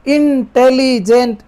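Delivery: Intelligent slowed and segmented into its syllables. Shown as a demonstration. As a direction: say intelligent slowly, with a small break after each syllable. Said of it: "इसमें अपने रुक रहे हैं".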